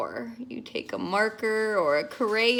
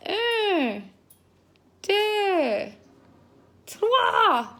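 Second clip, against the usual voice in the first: high French counting; 9 semitones higher, much slower than usual. Shown as a demonstration.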